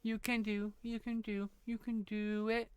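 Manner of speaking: soft and slow, coaxing the sister along; softly reassuring sister while she draws; gentle encouragement. Pretend. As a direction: hush it out like a patient cheerleader.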